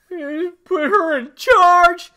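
high, loud, slow sneering marine; Hudson quote. Retold or imitated. Fan imitating Hudson with a loud slow sneer. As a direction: drag it out, loud and mocking. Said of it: "God, he was awesome".

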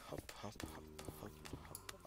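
soft unvoiced hops under the breath; quiet rhythmic whisper while climbing; whispered counting. Real, not acted. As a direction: whisper each hop to yourself.

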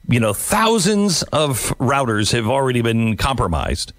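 ordinary talk, nothing marked

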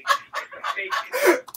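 high, loud punchline; comic yell. Acted. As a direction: belt the last words. Rant punchline yelled.

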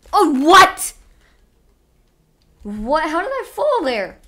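loud high confused outburst; baffled by a fall; stunned surprise. Real, not acted. Falling unexpectedly.

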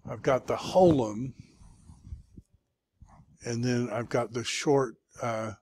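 normal talking voice

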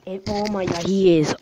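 usual speaking voice

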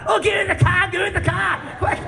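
high frantic yell, half laughing; hammed-up panic, hurry them in; panicked shout. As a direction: guitarists yelling at the band to jump in the car, panicked shout.

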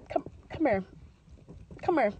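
ordinary delivery

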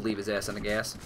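ordinary talk, nothing marked

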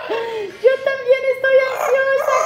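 high, laughing, half-shouted; laughing squeal. Real, not acted. Vlogger admitting she is anxious, laughing squeal.

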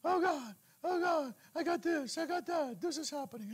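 high, whiny panicked "oh God"; hammed-up crybaby pleading; mock crybaby. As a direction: whimper it like a scared child. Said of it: "Now I'm like a God crybaby".

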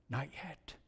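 very soft, low, near whisper; calm refusal. Acted. Master answering the teacup's plea, quietly refusing. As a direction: refuse gently, barely voiced.

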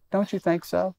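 ordinary delivery

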